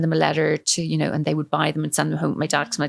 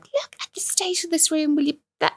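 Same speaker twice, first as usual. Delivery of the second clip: mock scolding mum; 12 semitones higher than usual. Mum scolding daughter's messy room.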